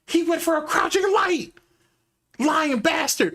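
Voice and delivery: high, indignant callout; excited outrage. Real, not acted. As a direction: call him out, high and outraged. Excited outrage at crouching light.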